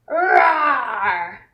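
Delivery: put-on a playful roar for children; tiger roar. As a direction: growl it out like a friendly tiger. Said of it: "pretend to be a tiger".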